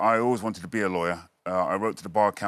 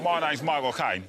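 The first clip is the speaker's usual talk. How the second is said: lightly done Cockney Caine voice; mock Michael Caine, a touch higher; Michael Caine impression; 7 semitones higher than usual.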